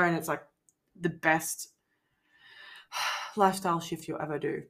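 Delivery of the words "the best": ordinary delivery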